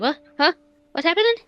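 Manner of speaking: normal talking voice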